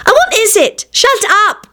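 high-pitched irritated snap; snapping. A narrator's reading. Rosie to her barking dog, snapping shrilly. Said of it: "Rosie snapped at her".

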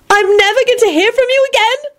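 very high wailed question; wailing. A narrator's reading. Elizabeth losing Lupin, wailing. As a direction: wail it in disbelief, rising at the end. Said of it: "Elizabeth wailed".

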